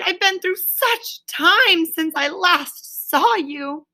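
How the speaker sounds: high and slow, overwhelmed; breathless relief. A narrator's reading. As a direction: pour it out, slowly and high. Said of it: "he cried".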